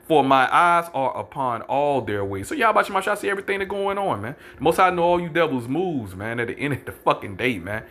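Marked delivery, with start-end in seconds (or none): 0.1-2.5 slowed verse, each word spaced; slow emphatic scripture reading. Shown as a demonstration. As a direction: drag the verse out deliberately. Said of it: "let me read it slow".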